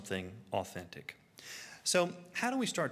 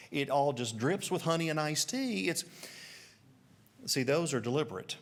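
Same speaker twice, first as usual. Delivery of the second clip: ordinary delivery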